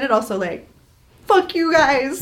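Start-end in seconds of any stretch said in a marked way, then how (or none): 1.3-2.2 high, drawn-out playful curse; pitched-up, half-joking grievance; mock indignation. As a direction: curse them in hammed-up outrage.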